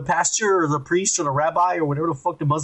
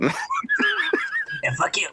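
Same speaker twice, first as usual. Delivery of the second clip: slow, much higher, cracking into laughter; laughing through it; 4 dB quieter, much slower than usual. Real, not acted. Teasing his co-host while laughing, laughing. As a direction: let the laugh take over the words.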